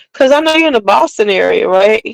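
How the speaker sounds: usual speaking voice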